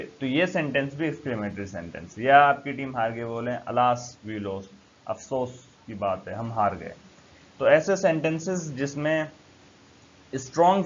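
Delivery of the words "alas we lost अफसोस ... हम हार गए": mock disappointment. Shown as a demonstration. Example: your team lost.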